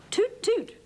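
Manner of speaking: high, bright toot toot; playful high tractor horn; tractor hoot. A narrator's reading. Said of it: "hooted Tractor Max".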